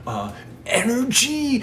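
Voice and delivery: high, slow, questioning guess; excited guess. Retold or imitated. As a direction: offer it as a high-pitched question. Friend guesses, retold heated debate.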